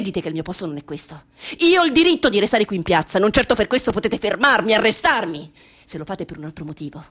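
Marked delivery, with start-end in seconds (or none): 1.6-5.5 loud, high defiance; defiant outburst. Acted. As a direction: stand your ground. Defiance to police. (sfida ad alta voce)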